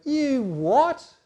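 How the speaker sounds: loud, high "you what?" blurted out; outraged disbelief. Acted. Brothers hearing Joseph's boast, shouting in disbelief. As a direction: blurt it in shock.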